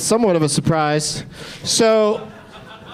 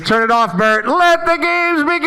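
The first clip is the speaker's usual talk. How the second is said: game show announcer; 8 semitones higher than usual. Acted. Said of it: "this is the perfect time to say".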